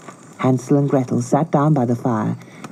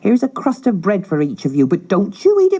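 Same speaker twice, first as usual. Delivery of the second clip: harsh stepmother; 11 semitones higher than usual. Acted. Stepmother ordering children.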